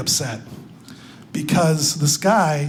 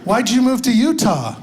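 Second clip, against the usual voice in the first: put-on higher-pitched naive Southern girl; Southern accent; 5 semitones higher, 4 dB quieter than usual. Comedian voices waitress texting a rude customer, mock Southern accent. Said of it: "a pretty Southern girl like you".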